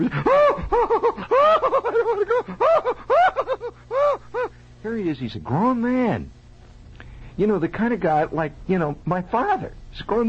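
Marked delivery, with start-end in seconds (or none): 0.0-4.5 very high, hammed-up bawling; crying and screaming. Patient dragged back by two dentists, bawling in high-pitched panic. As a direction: wail like a grown man having a tantrum. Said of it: "fathers crying and screaming".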